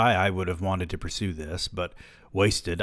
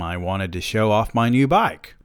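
plain reading voice

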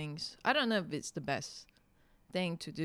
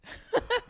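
sudden high laugh; burst of laughter; 7 dB louder than usual. Real, not acted. Laughing at the compliment.

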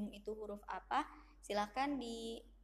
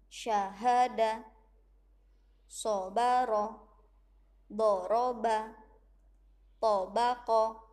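each word read slowly and louder, with a pause after each; clear demo reading; 9 dB louder, much slower than usual. Shown as a demonstration. Teacher reading a word list aloud for pupils to follow. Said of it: "Bunda bacakan Umi satu".

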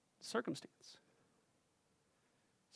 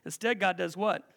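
ordinary talk, nothing marked

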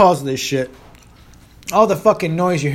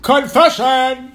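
slow, loud, higher proclamation; mock announcement; 6 semitones higher, 6 dB louder, much slower than usual. Announcing his weed confession to viewers as a loud slow proclamation. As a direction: announce it like a game show reveal.

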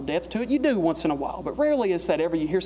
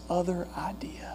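whisper; 8 dB quieter than usual. Real, not acted. Drops to a whisper after preaching.

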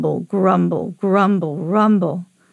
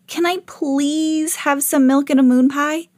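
put-on higher boyish pleading voice; 7 semitones higher than usual. A narrator's reading. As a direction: ask mom sweetly, drawing out please. Boy begs mom for a treat.

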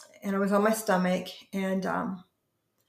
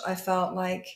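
usual speaking voice

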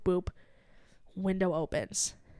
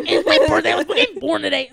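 loud, high, laughing hammed-up boast; mock birthday excitement; 4 semitones higher, 8 dB louder, faster than usual. Customer parodying a birthday announcement to barista, over-excited.

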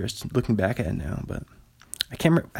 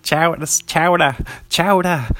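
mock New England accent; 5 semitones higher, 4 dB louder, slower than usual. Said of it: "He's like".

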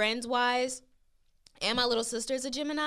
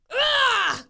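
loud, high-pitched frustrated yell; exasperated scream; 12 semitones higher, 9 dB louder than usual. Acted. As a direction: let out a fed-up scream. Acts out exasperation with Geminis.

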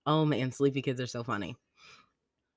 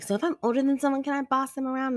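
high, quick child imitation; persistent kid; 13 semitones higher, 4 dB louder, faster than usual. Daughter still asking about bossing others, persistent. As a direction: press the question in a high, hurried kid voice. Retold child pressing again.

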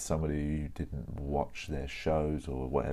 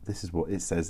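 ordinary talk

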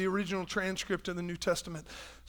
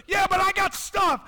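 mock objection; 10 semitones higher, 5 dB louder than usual. Believer objects to Jesus.

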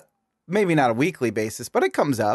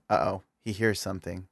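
ordinary delivery

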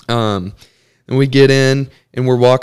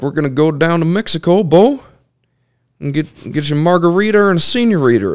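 put-on higher, fast, excited old-timer voice; imitating great uncle; 6 semitones higher, faster than usual. Great uncle inviting nephew to Mexico, high fast voice. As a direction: pitch it up and rattle off the invite like a party plan. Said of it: "I just do all their voices".

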